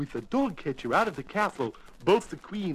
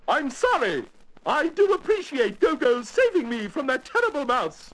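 high regal voice; a high-voiced monarch politely laying down the law; apologetic but firm Queen; 8 semitones higher than usual. Queen refuses Gogo at her party, apologetic but firm.

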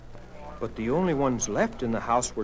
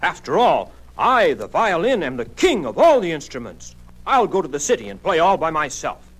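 loud, self-important violin; puffed-up king of the instruments; grand boasting; 3 semitones higher, 8 dB louder than usual. A narrator's reading. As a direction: declare it proudly, at full voice.

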